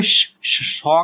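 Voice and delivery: hissed "sh" held alone before the vowel; initial sound shown. Shown as a demonstration. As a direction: draw out श- twice, then open it into "sho". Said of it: "सौ का sound आ रहा है".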